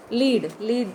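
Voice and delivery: "lead, lead" both with long ee; lead as LEED. Shown as a demonstration. Teacher repeats the word for students, long-ee "lead". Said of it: "I pronounce the word lead as".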